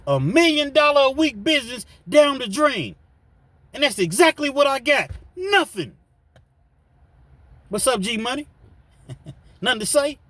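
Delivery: high, loud, mocking gangster voice; Nino quote. [name] quoting Nino Brown at his wife, loud and mocking. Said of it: "What Nino say in New Jack City".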